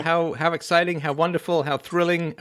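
high, loud, over-the-top enthusiasm; gushing excitement. Real, not acted. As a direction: pile on the delight. Host gushes over engagement news.

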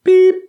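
high, loud beep; imitated machine beep. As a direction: squeak it out like an answering machine tone.

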